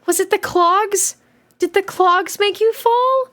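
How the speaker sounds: high, drawn-out character voice; slow high-pitched leading question; concerned questioning. Acted. Acted question to crying girl.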